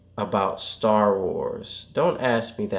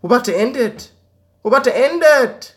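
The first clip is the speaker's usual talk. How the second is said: much higher and louder, singsong; high loud announcement; 13 semitones higher, 7 dB louder than usual. Pretend. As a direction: belt it out playfully. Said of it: "repeat builds".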